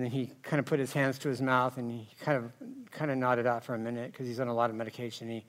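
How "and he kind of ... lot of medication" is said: usual speaking voice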